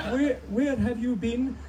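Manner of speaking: high, slow mock Queen's English; Queen accent. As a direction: draw out a high-pitched impression of the Queen's accent. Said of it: "That's my Queen accent".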